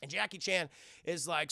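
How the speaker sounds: ordinary delivery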